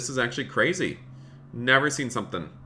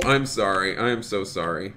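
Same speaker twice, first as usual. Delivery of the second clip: ordinary talk, nothing marked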